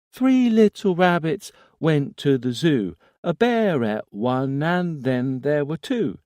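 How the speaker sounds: put-on high, loud childlike rhyme; eerie child chanting; little boy voice. A narrator's reading. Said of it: "small, infantile voice".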